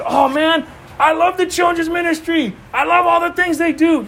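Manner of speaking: hammed-up enthusiasm, pitched high; mock gushing. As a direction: gush like an easily impressed fan. Mimics shallow gushing churchgoers.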